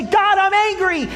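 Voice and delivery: a believer's angry cry to God; angry prayer. Acted. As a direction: let the frustration burst out. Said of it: "come to him and say".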